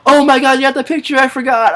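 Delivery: high, loud, thrilled gasp of a reaction; a burst of fan excitement, pitched high; excited squeal. Retold or imitated. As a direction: squeal it like you just caught the clue. Excited reaction to Hannah's clue.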